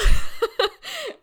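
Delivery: loud, high laugh; real high-pitched cackling laugh; burst of laughter. Real, not acted. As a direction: burst out laughing at your own reveal. Bursts out laughing at her reveal.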